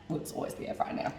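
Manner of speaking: fast whispered aside to herself; fast breathy correction of herself. Real, not acted. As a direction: slip it in under the breath, quickly.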